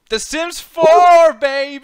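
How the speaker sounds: high, drawn-out hype announcement; loud, stretched-out celebration; triumphant shout. Real, not acted. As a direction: bellow it like a game-winning call. Announces own pick triumphantly.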